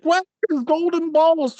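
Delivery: high-pitched mock bewilderment; startled awake. Imagined listener waking up to that part, startled. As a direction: blurt it out like a suddenly woken, baffled listener. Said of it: "somebody's asleep and then they wake up".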